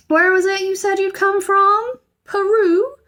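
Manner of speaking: loud, high stern questioning; stern housekeeper. A narrator's reading. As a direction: interrogate him. Mrs Bird questioning sternly.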